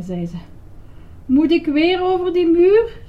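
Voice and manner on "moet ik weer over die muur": high, loud protesting question; exclaiming. A narrator's reading. Grover sent over the wall, exclaiming. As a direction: cry out in mock dismay. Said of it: "riep Grover uit".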